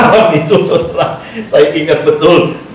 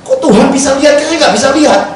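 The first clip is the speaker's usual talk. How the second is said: surprised protest; 9 semitones higher than usual. Retold or imitated. Retold protest to God.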